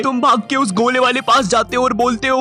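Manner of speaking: ordinary talk, nothing marked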